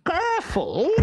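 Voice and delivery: high sharp cry; alarmed master. Acted. Stentorello in the trunk, high and alarmed. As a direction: warn him not to drop you.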